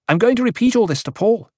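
high-pitched, heated threat; affronted and sharp; indignant threat. A narrator's reading. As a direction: threaten to tell, voice shooting up. Acted quarrel, threatens to tell.

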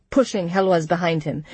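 usual speaking voice

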